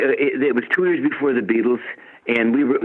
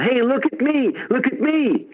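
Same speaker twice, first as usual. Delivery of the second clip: high-pitched attention-seeking call; mock yell, pitched way up without much extra volume; acted yell; 12 semitones higher than usual. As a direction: call out over a crowd to be noticed.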